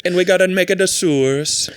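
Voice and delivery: hammed-up sing-song Italian accent. Ancient Roman planning public works, Italian accent. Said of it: "with an Italian accent".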